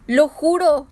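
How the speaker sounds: loud, hoarse oath; hoarse echo. A narrator's reading. Woman swearing to the avenging sailor, hoarse loud oath. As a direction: rasp out the vow. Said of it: "un ronco eco de su boca plana".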